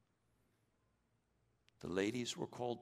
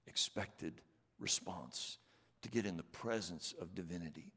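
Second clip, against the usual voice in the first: normal talking voice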